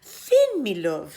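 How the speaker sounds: put-on a wife calling her husband home in Irish accent; Una calling in Irish accent. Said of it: "said Una, greeting him at the door".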